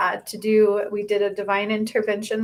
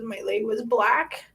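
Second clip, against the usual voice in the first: ordinary talk, nothing marked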